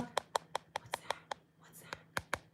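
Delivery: soft whisper to the cat. Real, not acted. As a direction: whisper gently to the kitten.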